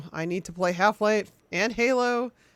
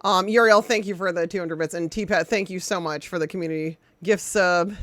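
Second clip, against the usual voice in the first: normal talking voice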